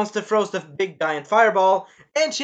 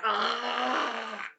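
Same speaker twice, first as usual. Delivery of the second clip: drawn-out, softer fake creature cry; mock monster scream; 4 semitones higher, 6 dB quieter than usual. Teller imitates the stabbed monster, long mock scream. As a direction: hold a half-hearted monster wail. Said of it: "that's the monster scream".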